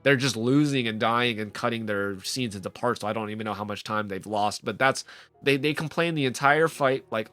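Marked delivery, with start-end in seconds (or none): none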